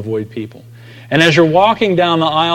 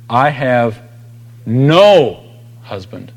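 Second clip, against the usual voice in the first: I have NO husband, weight on no; stress on no; much slower than usual. Shown as a demonstration. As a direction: lean hard on no, let husband fall off. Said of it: "the way it's emphasized in the original language".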